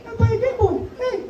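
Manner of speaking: put-on high pleading kid voice; whiny little sister. Little sister nags brother for his Game Boy, high whine. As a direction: beg like a pestering little kid.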